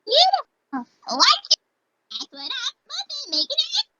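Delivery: high bubbly Pinkie; Pinkie Pie voice. Acted. Pinkie praising Rarity's dresses, high and bubbly. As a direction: bounce up into Pinkie.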